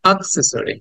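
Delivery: "অ্যাক্সেসরি" with a short "আ" first, for repeating; "অ্যাক্সেসরি" starting like a brief "uh", not "ae"; short "a" opening. Shown as a demonstration. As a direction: lead the class in "অ্যাক্সেসরি" as "ak-SES-uh-ree". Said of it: "বলো আমার সাথে".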